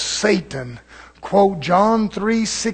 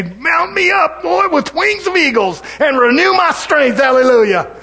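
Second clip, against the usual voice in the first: high, loud prayer shout; fervent calling out; 9 semitones higher, 7 dB louder than usual. Acted. Tired believer calling on God, fervent shouted prayer. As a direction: cry out to God at full force. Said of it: "Call on him. Say".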